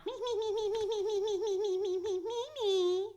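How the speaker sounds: high, loud, chirping mimicry; Snoopy mocks Woodstock's chatter, high and loud; mocking chirp. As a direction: mimic a yapping little bird.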